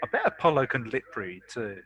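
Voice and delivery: ordinary delivery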